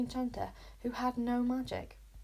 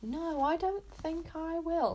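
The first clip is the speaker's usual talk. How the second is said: higher, slow, smiling refusal; gloating smiling bully; smug smile; 7 semitones higher, slower than usual. A narrator's reading. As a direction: savour saying no. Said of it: "smiled Luther".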